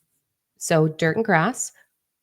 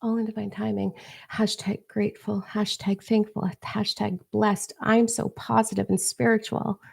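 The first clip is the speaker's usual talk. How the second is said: higher, softer, airy mock-serene voice, drawing out the hashtags; mocking fake spirituality; 4 semitones higher, 4 dB quieter than usual. Coach mocking followers who wait for the universe, in a soft, high, mock-blissful voice. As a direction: parody a dreamy self-proclaimed spiritual person. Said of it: "If you wanna waste your time being like".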